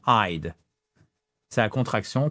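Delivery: ordinary delivery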